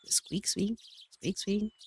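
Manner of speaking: lightly voiced little bird squeaks; playful squeaky bird noises. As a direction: chirp it out like a small bird. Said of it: "How about the bird noises?".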